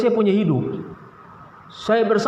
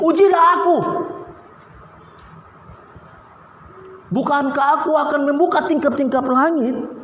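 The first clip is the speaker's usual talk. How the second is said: voice of God; 9 semitones higher than usual. Acted. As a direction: cry out God's challenge from heaven. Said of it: "Tuhan bilang ayat selanjutnya".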